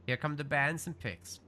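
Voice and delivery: ordinary talk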